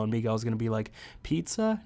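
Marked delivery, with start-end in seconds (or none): 1.2-1.8 high questioning "Pizza?"; mock puzzlement. Voicing Miguel seeing the truck, mock puzzled. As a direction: pitch it way up as a question.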